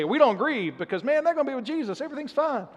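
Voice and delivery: high, breezy singsong of false cheer; mock cheerful. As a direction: toss it off like empty comfort. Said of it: "He didn't say".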